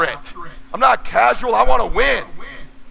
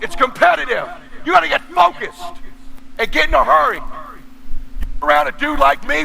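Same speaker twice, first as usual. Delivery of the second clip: fired-up yelling; 4 semitones higher than usual. Real, not acted. Coach yelling at casual salespeople to get focused. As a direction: hammer each line like a coach chewing someone out.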